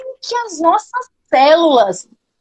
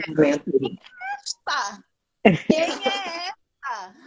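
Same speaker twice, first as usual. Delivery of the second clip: soft, drawn-out amazement; amazed; 11 dB quieter, slower than usual. Retold or imitated. Coach not recognising her transformed client, amazed. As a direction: stretch it out in hushed disbelief.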